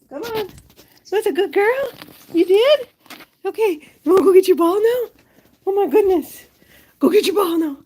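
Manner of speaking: very high, loud, playful pet voice; squeaky-high cheering for a good dog; excited dog talk. Real, not acted. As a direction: hype up the dog to chase the ball. Excited play with her dog.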